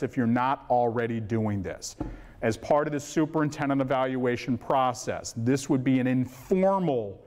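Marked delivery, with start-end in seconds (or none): 6.1-7.2 INFORMAL drawn out and pitched up; stress on informal. Real, not acted. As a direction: lean hard on the word informal. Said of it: "I stress that word".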